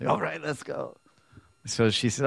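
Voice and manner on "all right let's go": higher, softer resigned voice; mock resigned sigh. Krishna resigning himself to the tired party, higher and softer. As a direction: give in with a sigh.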